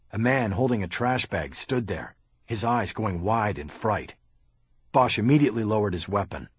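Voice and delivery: ordinary delivery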